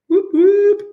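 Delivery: high, loud celebratory whoop; cheering whoop. Real, not acted. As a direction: cheer them in. Cheering students joining.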